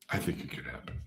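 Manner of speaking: soft unvoiced whisper to herself; whispered aside. Real, not acted. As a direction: whisper the answer like a secret. Answering her own question.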